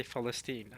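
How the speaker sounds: Palestine said with F for P; Arabic pronunciation. Shown as a demonstration. Streamer telling viewers the Arabic Palestine, said "Falesteen". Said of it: "right, with an F".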